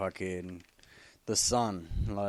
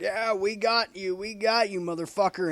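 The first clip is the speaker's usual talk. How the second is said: much higher, louder mocking thug voice; thugs taunting; 10 semitones higher, 5 dB louder than usual. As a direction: jeer like a street goon. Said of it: "They're like".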